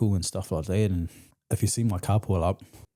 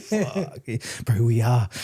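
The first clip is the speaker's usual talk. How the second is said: high, loud laughter breaking through; laughing reply; 8 semitones higher, 7 dB louder, slower than usual. Retold or imitated. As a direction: agree through a burst of laughter. Laughs while retelling his reply.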